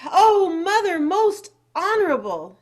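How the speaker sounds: high, loud, breath-taken plea; hammed-up panting. Chang out of breath before his mother, panting. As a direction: play a boy panting out his appeal. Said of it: "he panted".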